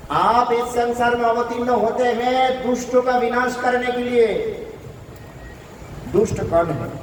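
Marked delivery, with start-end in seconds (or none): 0.1-4.3 acted entreaty, pitched high throughout; high, sustained appeal of imploring wives; mock tearful plea. Kaliya's wives beg the Lord, in a mock tearful plea. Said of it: "रो रो के कहने लगे".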